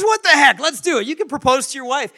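high, loud, throw-caution-away excitement; enthusiastic agreement. Retold or imitated. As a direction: burst out with a spontaneous yes. Neighbor gives in with enthusiasm.

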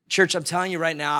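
ordinary delivery

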